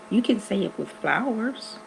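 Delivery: usual speaking voice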